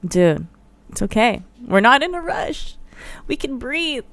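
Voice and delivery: soft, soothing; calming reassurance. Retold or imitated. Calming her anxious driving partner, soft reassurance. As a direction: talk him down gently. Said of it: "trying to tell him".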